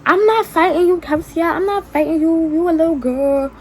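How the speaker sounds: mocking Alicia. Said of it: "She's like".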